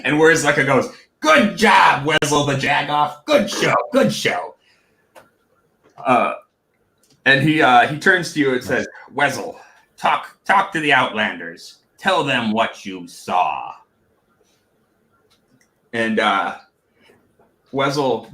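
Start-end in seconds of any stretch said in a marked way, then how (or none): 1.2-4.5 gleeful goblin chief. Acted. Chief praises jester's low blow. 9.1-13.7 commanding goblin chief. Acted. Chief gives the jester an order.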